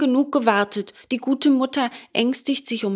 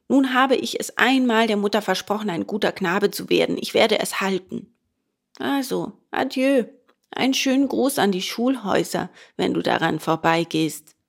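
usual speaking voice

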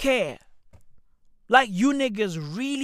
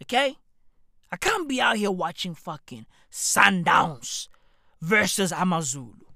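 normal talking voice

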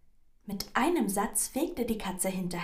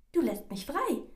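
higher, lightly voiced mouse; prompt mouse reply; 8 semitones higher, 4 dB louder than usual. A narrator's reading. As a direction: answer at once as the little mouse. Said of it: "antwortete die Maus rasch".

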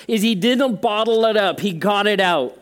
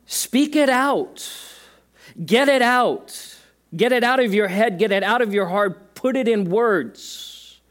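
high, slow and emphatic, each word drawn out; slow urging command; 5 semitones higher than usual. Acted. Preacher urging the congregation to speak it out, slow and high. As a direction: plead with them slowly to let it out.

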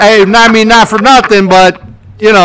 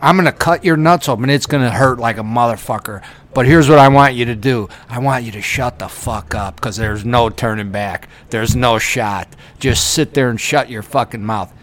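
lower, gruff no-nonsense surgeon; blunt tough doctor; 6 semitones lower, 3 dB quieter than usual. Retold or imitated. What the doctor should have told the patient, gruff and low. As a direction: lay down the law, low and blunt.

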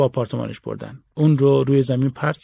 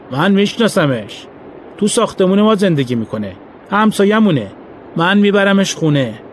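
sly neighbor; 5 semitones higher, 4 dB louder than usual. A narrator's reading. Neighbor driver claims him.

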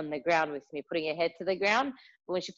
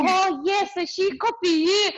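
high, louder, broken-English educator; educator's voice; 11 semitones higher, 5 dB louder, slower than usual. Retold or imitated. Educator tells the mum her daughter copies her, imitated high and loud. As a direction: play the educator realising it.

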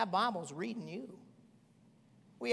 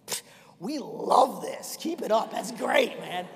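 loud, higher, delighted cheer; excited; 5 semitones higher, 9 dB louder, slower than usual. Retold or imitated. Church members cheering Chrissy on, enthusiastic. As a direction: cheer her on. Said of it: "We're like".

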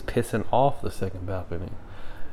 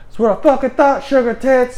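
high, louder gloating imitation; mock triumphant taunt; 13 semitones higher, 5 dB louder than usual. Soldier taunting the departing civilian, mock triumph. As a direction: toss it out as a parting jab.

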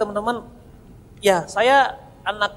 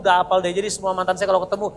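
ordinary talk

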